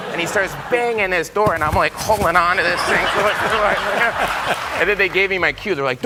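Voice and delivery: ordinary talk, nothing marked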